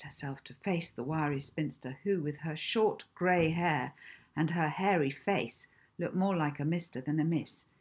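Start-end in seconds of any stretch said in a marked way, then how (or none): none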